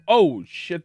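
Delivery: startled by a sudden noise. Real, not acted. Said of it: "that was terrifying".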